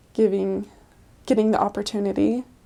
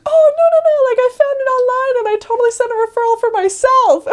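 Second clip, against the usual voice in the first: much higher, louder squeal of delight; excited old lady; 14 semitones higher, 8 dB louder, faster than usual. Retold or imitated. Old woman greeting missionaries at her door, delighted squeal. Neva explains cheerfully.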